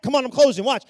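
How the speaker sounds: normal talking voice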